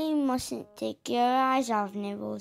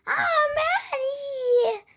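panicked cry; 3 dB louder, much slower than usual. Acted. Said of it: "he's just like".

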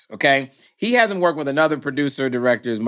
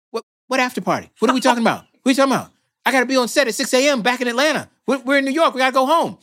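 higher, rushed, frantic; panicked rant; 8 semitones higher, much faster than usual. Retold or imitated. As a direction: rattle it off in a panic. Panicking about the flight.

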